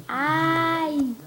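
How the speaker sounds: high, drawn-out mock complaint; acted exasperation. Friend learns it was a prank, mock exasperation. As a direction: stretch it high, imitating the friend.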